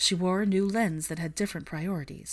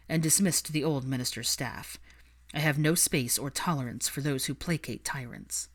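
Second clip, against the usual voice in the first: a hint of a lower, firm minister; steady, slightly lowered authority; Kingsley, firm and stern; 3 semitones lower than usual. Kingsley voiced in story.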